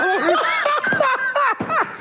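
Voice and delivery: high-pitched cackle; bursting high laugh. Real, not acted. Friend laughing at ref threat, high cackle. Laughing at Mark Davis story.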